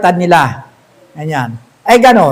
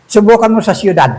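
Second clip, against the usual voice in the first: ordinary talk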